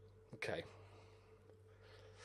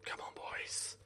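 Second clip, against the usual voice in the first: whispered urging; 15 dB quieter, slower than usual. Real, not acted. Gamer whispers encouragement to his team. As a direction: whisper it like praying.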